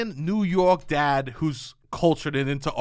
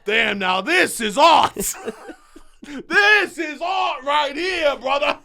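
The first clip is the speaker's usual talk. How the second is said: dad shouting in awe; 7 semitones higher, slower than usual. Retold or imitated. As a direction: bellow it like a thrilled New York Italian father. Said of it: "He goes".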